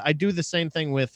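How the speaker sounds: ordinary talk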